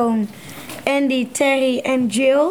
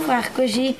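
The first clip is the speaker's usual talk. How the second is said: plain reading voice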